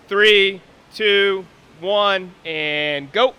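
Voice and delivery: loud countdown. Real, not acted. Counting down the clock.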